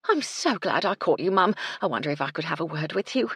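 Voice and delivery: breathless, eager housekeeper, quickening at the end; a bustling cook hurrying up to her employer; Mrs Chubb voice. Acted.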